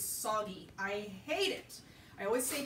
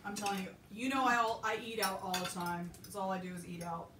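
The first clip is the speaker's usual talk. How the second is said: ordinary delivery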